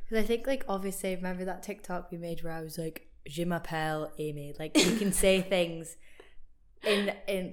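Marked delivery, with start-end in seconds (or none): 3.3-4.5 French said in a Scottish accent; unadapted Scottish-accented French; French in own accent. Shown as a demonstration. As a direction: keep your Scottish accent while speaking French. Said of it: "different language in your own accent".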